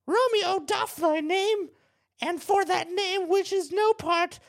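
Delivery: put-on high, loud old hag voice. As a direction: play Juliet as a cackling old crone. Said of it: "I just wanted to sound like an old hag".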